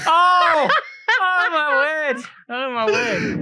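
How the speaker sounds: high, loud wail of disbelief; slow, high "oh my word", easing off; real exasperated outcry. Real, not acted. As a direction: cry out as if you've been had. Loses the quiz, cries out.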